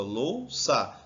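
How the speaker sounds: Louça divided as lou, ça; Louça in two separate beats; syllable by syllable. Shown as a demonstration. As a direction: put a break between the two syllables of Louça. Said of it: "Divide aqui".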